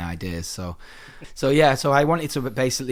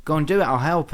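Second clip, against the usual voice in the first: higher-pitched quoted reassurance; retold pep talk, pitched up; encouraging ex-girlfriend; 7 semitones higher than usual. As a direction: lightly imitate her urging him on. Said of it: "And she was like".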